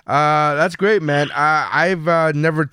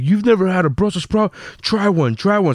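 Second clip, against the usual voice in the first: higher, pressing mock insistence; eager pushy friend; 6 semitones higher than usual. Healthy comedian in the green room urging a taste, mock eager. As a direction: lightly egg him on to try one.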